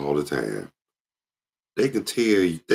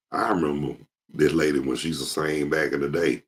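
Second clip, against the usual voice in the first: usual speaking voice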